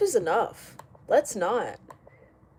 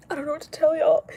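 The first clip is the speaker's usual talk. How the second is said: much higher and louder insistence; exasperated repeat; 8 semitones higher, 6 dB louder, faster than usual. Real, not acted. Streamer fed up with lip filler comments, high exasperated voice. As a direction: throw it out high and loud.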